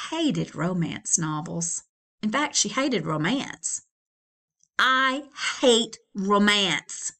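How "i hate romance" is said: slow declaration. Acted. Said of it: "said Flora out loud".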